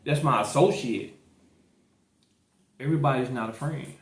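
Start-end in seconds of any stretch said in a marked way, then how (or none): none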